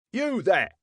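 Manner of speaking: high-pitched challenge; calling out. A narrator's reading. Young constable spots a man by the body, calling out. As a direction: call sharply to stop a suspect.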